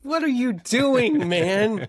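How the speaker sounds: high, slow, loud disbelief; what on earth are you doing; incredulous. Real, not acted. Reacting to partner's odd bit.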